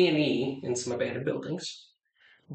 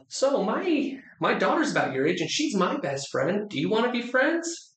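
sweet high voice; 9 semitones higher than usual. Retold or imitated. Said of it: "And I'm like".

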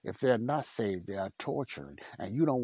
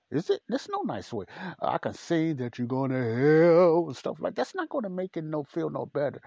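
shouted high and loud, mock harsh; mock angry yell; 8 semitones higher, 9 dB louder than usual. Shown as a demonstration. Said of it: "Or I can say it in an angry way".

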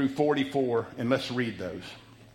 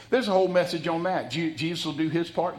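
ordinary talk

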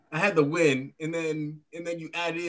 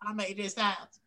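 lightly put-on country accent, higher and softer; a hint of a wrestler's country accent; A J Styles; 6 semitones higher, 6 dB quieter than usual. Podcaster joking about wrestlers' accents, soft high country-accent imitation. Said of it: "I'm surprised he lets A J Styles cook".

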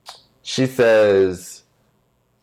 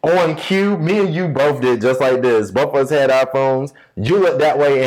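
normal talking voice

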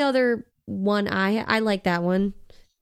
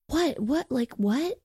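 mock-baffled, rising questions; each "what" lifting higher, imitating confusion; baffled questioning; 5 semitones higher, slower than usual. Girl hearing gossip on the phone, mock-baffled.

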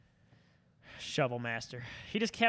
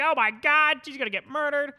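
panicked; 10 semitones higher, 7 dB louder than usual. Retold or imitated. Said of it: "I was like".

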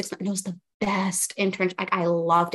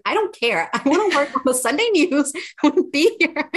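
laughing eagerness; 8 semitones higher, 6 dB louder than usual. Retold or imitated. Retelling her eager reply to excited people.

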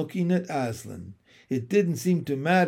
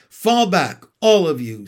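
commanding; 2 semitones higher, 8 dB louder than usual. A narrator's reading. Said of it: "said Aslan".

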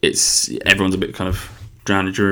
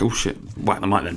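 ordinary talk

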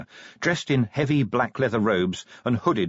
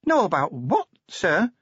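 put-on higher, timid young dwarf voice; nervous; 9 semitones higher than usual. A narrator's reading. Cheery answering the angry Vimes, nervous and higher. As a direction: answer anxiously, voice lifted high. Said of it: "said Cheery nervously".

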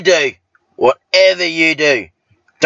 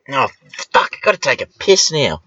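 ordinary talk, nothing marked

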